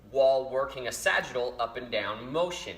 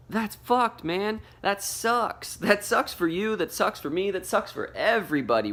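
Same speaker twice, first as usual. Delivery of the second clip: raised, exaggerated sympathetic voice; high mock sympathy; 11 semitones higher, 4 dB louder than usual. Real, not acted. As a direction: pitch it way up, faux pity. Reacting to the quoted complaint.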